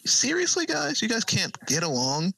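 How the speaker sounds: squeaky, fed-up high pitch; a high whine of disbelief; exasperated high voice. Retold or imitated. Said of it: "I'd be like".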